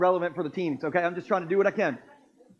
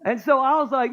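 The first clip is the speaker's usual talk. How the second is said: usual speaking voice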